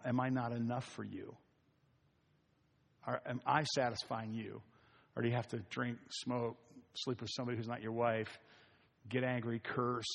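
soft, tender voice of Jesus asking; gently lovingly ask, not scolding; loving and gentle. Retold or imitated. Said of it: "he would very lovingly say".

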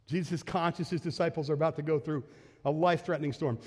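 ordinary delivery

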